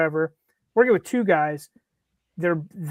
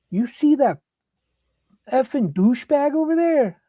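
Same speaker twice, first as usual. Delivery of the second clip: retold angry Boston fan; 7 semitones higher than usual. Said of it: "And he's like".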